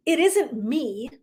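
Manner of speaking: ordinary talk, nothing marked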